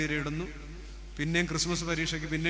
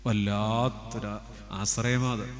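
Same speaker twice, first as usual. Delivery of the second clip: tender confession; 6 semitones lower, slower than usual. Acted.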